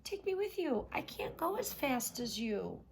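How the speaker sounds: high pleading frog voice. Acted. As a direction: beg her to wait. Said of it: "cried the frog".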